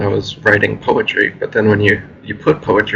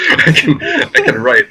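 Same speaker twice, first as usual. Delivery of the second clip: laughing up high through the words; breaking into a laugh mid-line; 4 dB louder than usual. Real, not acted. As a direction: giggle it out, embarrassed. Laughing while admitting weak rapping.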